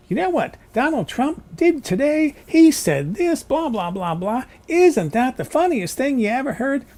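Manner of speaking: high, loud, gushing newscaster mockery; Rachel Maddow impression. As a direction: ham up a smug anchor sharing gossip. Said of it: "That's Rachel Maddow".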